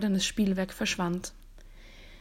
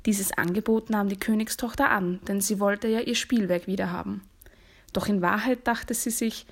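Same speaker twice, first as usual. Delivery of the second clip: ordinary delivery, nothing marked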